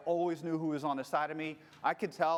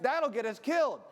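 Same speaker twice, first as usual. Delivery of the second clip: loud, higher angry warning; 7 semitones higher, 5 dB louder than usual. Retold or imitated. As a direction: scold her for putting you both in danger. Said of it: "And I'm getting angry".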